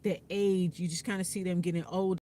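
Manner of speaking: usual speaking voice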